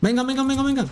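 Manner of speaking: high, urgent hurry-up calls; excited urging. Real, not acted. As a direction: push them to come on. Urging teammates on. (agudo y apurado)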